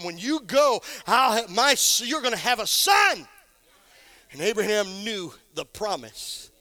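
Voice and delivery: building from firm to a shouted climax on "is a promise"; fiery preacher rising to a shout; preaching at full force. Acted.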